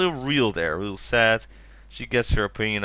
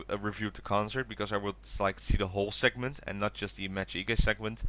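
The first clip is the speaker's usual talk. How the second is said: ordinary talk, nothing marked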